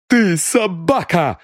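high, slow, venomous shout; furious cry. A narrator's reading. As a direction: spit the insult at him. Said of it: "закричала она". (миссис Крил кричит на сыщика: ты собака)

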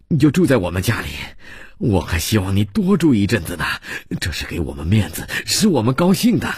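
hearty welcome. Acted. Host assures friend his stay is an honour, hearty welcome. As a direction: press the invitation warmly, lifting high at the end. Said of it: "这些热情的话".

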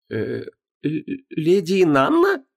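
high, slow, incredulous question; doubtful young woman. Acted. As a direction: ask it in disbelief, slowly. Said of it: "всё ещё недоверчиво уточнила Вон".

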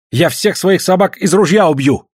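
high, vehement vow; passionate exclamation. A narrator's reading. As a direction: swear it hotly to a rival. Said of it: "со страстью воскликнул Айдамба".

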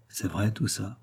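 very soft, hushed question; discreet aside. A narrator's reading. Magali asks Volpi discreetly, hushed aside. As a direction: slip it in confidentially. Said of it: "et lui glissa".

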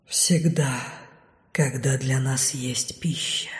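low, soft, then unvoiced; ominous shadow whisper. A narrator's reading. (зловещий шёпот теней)

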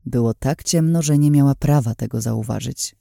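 usual speaking voice

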